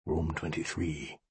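barely audible mouthing; mouthed. A narrator's reading. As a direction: shape the words with almost no voice. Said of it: "mouthed the message".

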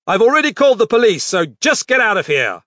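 fast, loud high yell; shouting. Acted. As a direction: shout the threat hard. Shouting at burglar through door.

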